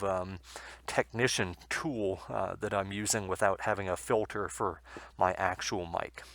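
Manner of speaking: normal talking voice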